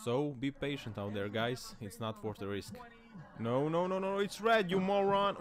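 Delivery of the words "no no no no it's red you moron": high, raised-voice rebuke; exasperated scolding. Real, not acted. Scolding a driver who misses the red, high exasperation. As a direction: yell at a driver on screen.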